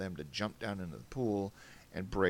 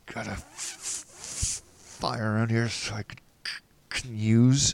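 shivering, catching on f and c; teeth-chattering stutter; 3 semitones higher, 4 dB louder, slower than usual. Acted. Freezing rescuer asking the scientist for a fire, shivering stutter. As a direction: force words out through shivers. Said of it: "my teeth chattering".

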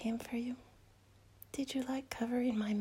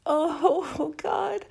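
loud moan; 5 semitones higher, 9 dB louder than usual. Acted. Pleasure cry.

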